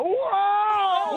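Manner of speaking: thrilled whoa, way up high; on a roller coaster. Pretend. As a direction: yell like the coaster just dropped. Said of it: "Pretend we're on a roller coaster right now".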